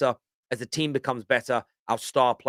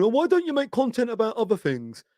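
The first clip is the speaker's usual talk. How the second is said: high-pitched whiny complaint; put-on high moan, mimicking complainers; mock moaning; 10 semitones higher than usual. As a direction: imitate viewers moaning in a much higher voice. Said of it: "You all moan".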